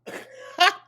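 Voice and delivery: loud high laugh. Real, not acted. Reacting to clip.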